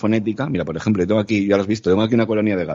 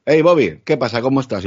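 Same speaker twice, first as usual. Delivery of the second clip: lively, higher-pitched hello to a pet; greeting a dog; 3 semitones higher, 3 dB louder than usual. Pretend. Owner chatting to the dog Bobby, lively greeting. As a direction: call out brightly to your dog. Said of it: "yo al perro le puedo decir".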